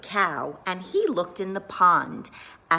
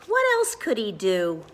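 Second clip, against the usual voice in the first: ordinary delivery, nothing marked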